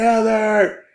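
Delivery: mild imitation of yelling for someone; mock calling out. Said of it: "he's yelling for his girlfriend".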